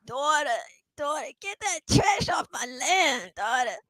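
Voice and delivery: high, soft, slow father with a cane; reedy, drawn-out old-timer voice; Walter Brennan. Acted. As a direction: stretch it out like an old farmer. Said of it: "him just going with a cane".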